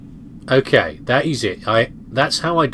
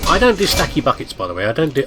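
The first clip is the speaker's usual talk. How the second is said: ordinary delivery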